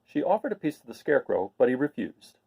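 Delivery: ordinary delivery, nothing marked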